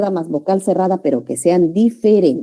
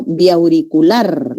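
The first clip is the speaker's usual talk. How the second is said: slow syllable demo; much slower than usual. Shown as a demonstration. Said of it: "la sílaba tónica está acá".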